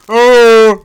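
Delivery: a big mic-blasting "oh"; hammed-up loud exclamation; loud mock shout. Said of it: "Plus profond?".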